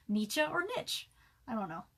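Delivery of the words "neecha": "Nietzsche" said as "nee-chuh"; two-syllable pronunciation. Shown as a demonstration. Writer offers another guess, "Nietzsche" as "nee-chuh". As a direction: say "Nietzsche" with a long ee and a final "uh". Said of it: "doesn't know how to pronounce words".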